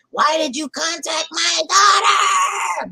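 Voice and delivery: slow high mock yell; mock shouting. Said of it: "quoting Jen".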